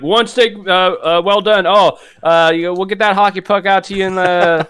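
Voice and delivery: high-pitched mock waiter, stammering; high mocking server voice. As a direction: squeak it out like a flustered cook. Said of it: "they're like".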